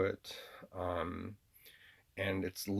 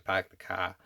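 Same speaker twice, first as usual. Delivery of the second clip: half-assed Boston accent; slower than usual. Shown as a demonstration. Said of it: "a half-assed Boston accent".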